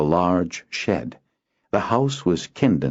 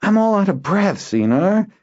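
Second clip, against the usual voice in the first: put-on high, louder boy's voice, pleading; boy Kinden; 3 dB louder than usual. Boy faking tiredness to dodge the errand. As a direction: feign tiredness.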